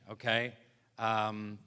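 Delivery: ordinary talk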